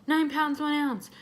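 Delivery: high, loud mocking call-out; mock shouted stats. Nurses calling out the baby's stats, mock shouting. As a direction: bark the numbers like a bored nurse. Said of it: "just screaming these out".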